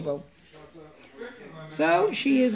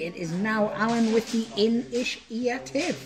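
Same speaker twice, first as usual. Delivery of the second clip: normal talking voice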